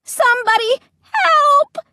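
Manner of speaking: high, loud cry for help; desperate shriek, trapped and crushed; panicked scream. A narrator's reading. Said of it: "I tried to scream".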